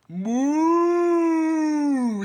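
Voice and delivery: loud, breathy moo; cow moo. A narrator's reading. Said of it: "said Cow".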